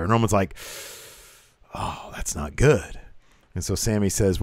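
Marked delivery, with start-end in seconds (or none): 1.7-3.0 low, drawn-out imitation of concern; slow mock concern. Boss hearing of trouble at the border, slow low concern. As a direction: draw it out slowly, pitched down. Acting Roman's reaction to bad news.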